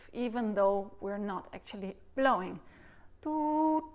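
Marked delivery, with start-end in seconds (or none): 3.2-3.8 sung "Toot" on a high held tone; a short high "Toot", like a recorder note; syllable toot. Shown as a demonstration. Recorder teacher demonstrating to students, a high sung "Toot". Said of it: "You see, it's active".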